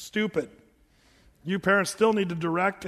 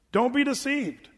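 ordinary talk

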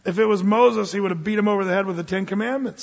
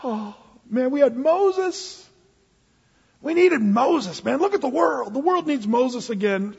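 higher, drawn-out mock complaint; bummed Peter; 6 semitones higher, slower than usual. Disciple grumbling that Moses has gone, mildly bummed. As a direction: moan it in mild disappointment. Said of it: "Peter was just slightly bummed".

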